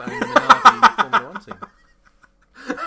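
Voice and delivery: loud, slow, laughing. Real, not acted. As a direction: laugh through the words. Laughing through joke.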